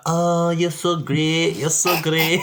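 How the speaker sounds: hammed-up Scottish accent, high and drawn out; Scottish god voice. The god Tyr praising Jacob, in a hammed-up Scottish accent. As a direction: gush in a put-on Scottish accent. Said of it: "I don't know what accent that was".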